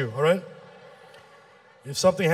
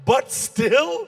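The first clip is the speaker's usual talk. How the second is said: higher, rising disbelief; disbelieving question that climbs at the end; incredulous echo; 5 semitones higher than usual. Acted. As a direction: throw it back as a stunned question. Comic echoes the weak comeback incredulously.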